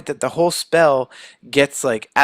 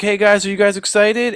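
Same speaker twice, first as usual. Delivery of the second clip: higher-pitched, eager mock greeting; excited greeting; 8 semitones higher, 4 dB louder than usual. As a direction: call out like an oblivious, excited friend. Flash greets friends, unaware of rejection.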